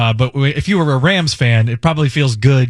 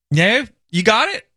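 usual speaking voice

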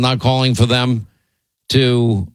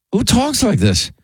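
much higher, disbelieving repeat; incredulous; 11 semitones higher than usual. Real, not acted. Host repeating the question in disbelief. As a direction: let the disbelief spike up.

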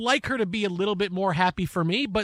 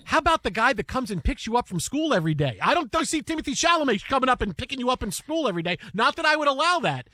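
aggrieved dad hammed up a little; mock outrage; 5 semitones higher than usual. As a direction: play the wounded dad, half joking. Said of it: "I'm like".

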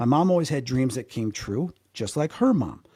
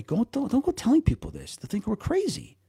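lightly voiced mother, much higher pitch; imitated cautioning mom; 9 semitones higher than usual. Mom cautioning her child, acted out.

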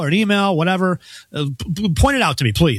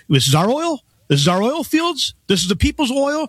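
lightly done Bernie voice, higher pitch; Bernie Sanders accent; 6 semitones higher than usual. Retold or imitated. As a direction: ham up an old senator's rally cry, pitched up. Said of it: "I'll use a Bernie Sanders accent".

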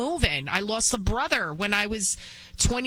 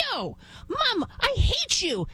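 put-on much higher, bratty child voice; whiny boy; 13 semitones higher, slower than usual. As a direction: whine like a spoiled kid. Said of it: "he's just like".

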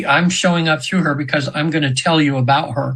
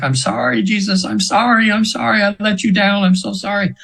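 high, mild mock wail of apology; retold screaming apology; 6 semitones higher than usual. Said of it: "she's screaming like".

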